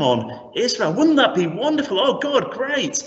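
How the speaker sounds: imagining a prophet's eager, rising hope; excited hope. Acted. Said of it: "imagine Habakkuk hearing that".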